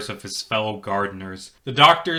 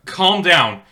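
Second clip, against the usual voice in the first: louder firm appeal; firm call; 7 semitones higher, 6 dB louder than usual. Acted. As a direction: raise the voice to settle him. Captain calms the gardener.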